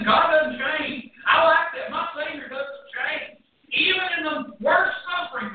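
loud, high preaching with shouted peaks; fiery preaching. Acted. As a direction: preach it hard to the congregation. Preaching at full force.

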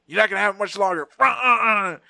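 loud, high, rapid threat into jabbing grunts; mock-menacing doctor. Doctor stabbing patient with his fingers, mock menacing.

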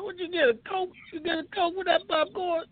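very high put-on dad; dad joke voice. Dad quizzing his kid about popcorn money, high put-on voice. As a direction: question it like a nagging dad, high-pitched. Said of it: "vintage dad jokes".

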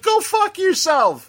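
high, slow angry yell. Retold or imitated. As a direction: spit it out in a high angry rage. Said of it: "And it's like".

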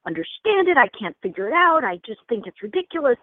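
high-pitched exasperated complaint; frustrated student. Acted. Student rejecting the intuition course, mock exasperated. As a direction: act out a student fed up with the lesson. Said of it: "one person is like".

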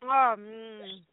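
talking with mouth full; muffled by a mouthful. Acted. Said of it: "talk with your mouth full".

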